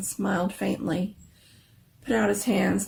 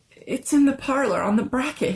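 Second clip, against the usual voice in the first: higher, louder, puzzled wonder; marveling; 6 semitones higher, 5 dB louder than usual. A narrator's reading. Husband answering his wife, marveling and higher. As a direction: answer her, bewildered.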